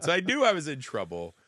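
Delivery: usual speaking voice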